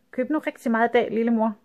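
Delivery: high, fast, sugary coaxing; eager sweet send-off. Acted. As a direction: rush her off with fake sweetness. Girl eagerly sending mother off.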